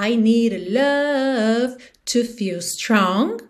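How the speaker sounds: slow, "need a" as "nee-ra", "love" stretched; tapped D. Shown as a demonstration. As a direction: tap "need a" and linger on "love". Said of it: "tem esse rã no final de need".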